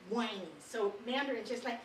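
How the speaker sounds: normal talking voice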